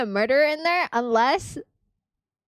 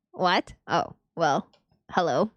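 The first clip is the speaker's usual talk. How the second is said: ordinary talk, nothing marked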